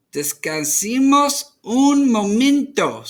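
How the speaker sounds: a tired invitation to rest; grandmother's voice. A narrator's reading.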